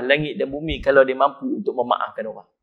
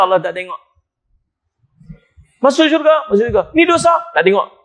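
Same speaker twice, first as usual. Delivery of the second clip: high, loud fiery preaching; preaching at full force; 5 semitones higher, 4 dB louder than usual. Acted. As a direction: preach it out with fire. Preacher raising voice on paradise.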